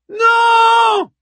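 long, high shouted refusal; crowd yell. A narrator's reading. The women on the ramparts answer the speaker, yelling. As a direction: roar it back like a thousand women. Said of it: "hurlèrent le millier de femmes".

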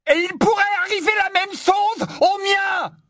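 very high, furious shout; yelling. A narrator's reading. Ron fears for his own family, yelling at Harry. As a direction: scream it back at him. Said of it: "hurla Ron".

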